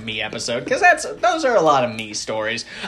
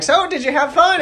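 mock cheerful parent, much higher and louder; parents' question; 4 dB louder than usual. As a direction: play the chirpy parent asking. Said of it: "her parents were like".

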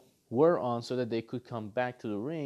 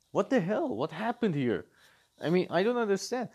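ordinary talk, nothing marked

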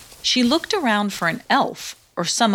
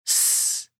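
soft unvoiced hiss; snake hiss; 6 dB quieter than usual. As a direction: hiss as you slide away. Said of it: "hissed Caw".

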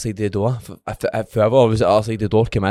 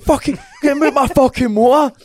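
furious yell; 14 semitones higher, 4 dB louder than usual. Retold or imitated. Retold row over his car.

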